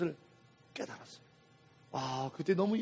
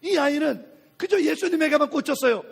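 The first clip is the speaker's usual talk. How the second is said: normal talking voice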